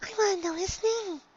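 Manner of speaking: high, soft pleading; shy pony begging for the dragon's name; soft eager plea. A narrator's reading. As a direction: ask gently but eagerly. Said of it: "cried the yellow Pegasus".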